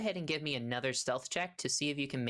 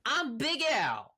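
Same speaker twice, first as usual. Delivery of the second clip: hearty half-orc; 9 semitones higher, 4 dB louder than usual. Acted. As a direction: announce yourself as a proud country character. Half-orc introduces himself.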